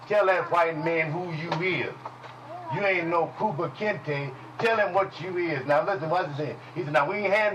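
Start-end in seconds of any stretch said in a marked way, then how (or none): none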